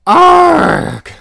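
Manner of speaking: high, loud exasperated roar; frustrated yell. Real, not acted. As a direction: let out a fed-up cry. Frustrated at the chatbot.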